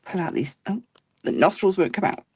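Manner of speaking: usual speaking voice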